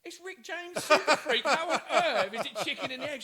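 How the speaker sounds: loud, high, laughing "How on earth"; incredulous, cracking up; high loud laughing protest; laughing disbelief. Retold or imitated. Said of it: "I was like".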